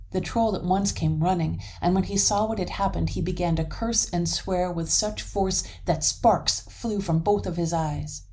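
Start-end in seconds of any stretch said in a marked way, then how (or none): none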